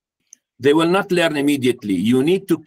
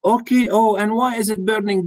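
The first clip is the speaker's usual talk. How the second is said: caring parent; 7 semitones higher than usual. Retold or imitated. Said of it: "Let her answer".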